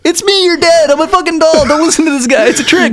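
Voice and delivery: high squeaky voice of a trapped doll; dad trapped in a doll warns his daughter, in a high squeak. Pretend. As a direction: plead in a tiny panicked doll squeak. Imagined doll-dad pleading.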